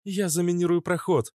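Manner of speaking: high-pitched female character; high, put-on woman's voice. A narrator's reading. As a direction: lift the pitch well up for the woman's line. Narrator voices Natasha offering.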